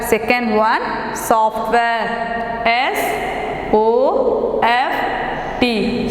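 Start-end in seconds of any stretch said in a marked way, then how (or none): 2.7-6.1 letters spelled out slowly one by one; slow spelling. Shown as a demonstration.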